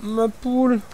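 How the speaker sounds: crié haut et fort, désespéré; cri de détresse. Real, not acted. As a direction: hurle de désarroi. Découvre sa poule morte. (cri désespéré)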